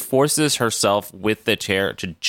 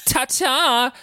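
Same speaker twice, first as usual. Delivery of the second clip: higher, sing-song mocking goodbye; campy ta-ta; 13 semitones higher than usual. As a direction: wave it off with a camp flourish. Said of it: "He literally says, "Ta-ta".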